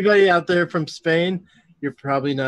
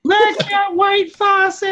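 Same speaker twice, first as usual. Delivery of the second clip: high, loud, drawn-out fan holler; mock fan call; 5 dB louder, much slower than usual. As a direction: stretch the name out like a hammed-up fan. Joins in the mock fan greeting.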